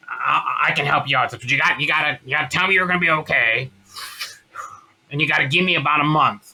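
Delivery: smoker mother's voice. Retold or imitated. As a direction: impersonate a smoker mother on the phone. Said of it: "I always wanna do her voice".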